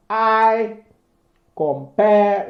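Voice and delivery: "I" and "compare" hit high and loud; stressed syllable. Shown as a demonstration. Teacher stressing "I compare" to show the iambic beat, loud and high. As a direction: lean hard on "I compare" as the strong beats. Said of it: "followed by one long stressed syllable".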